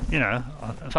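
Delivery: ordinary talk, nothing marked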